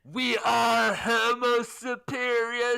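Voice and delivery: very slow, loud proclamation; grandiose voice of God. Acted. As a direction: announce it solemnly. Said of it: "I'm just gonna read all these lines".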